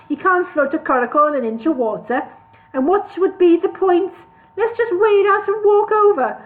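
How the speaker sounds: high, loud blustering dwarf; scoffing dwarf. A narrator's reading. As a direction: scoff at the whole idea. Tori voiced as scoffing dwarf.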